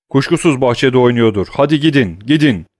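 loud, high hurried commands; urgent orders. Acted. Master urging the servants to search the garden, loud. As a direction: send them off at once.

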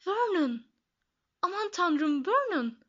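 high, choked cry of alarm; horrified gasp. A narrator's reading. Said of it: "hırıltılı bir ses çıkardı". (dehşetle, boğazı düğümlenmiş gibi)

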